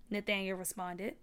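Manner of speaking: ordinary delivery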